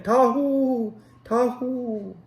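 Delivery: high, carrying call across the hills; shepherd calling his sheep by name. Acted. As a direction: call the lost sheep from far off. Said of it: "he stopped and called again".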